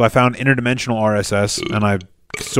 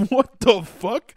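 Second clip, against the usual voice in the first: slow, higher what the fuck; mock bafflement; 11 semitones higher, much slower than usual. Host imitating his own reaction, slow and high. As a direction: drag it out high in disbelief. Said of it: "I'm like".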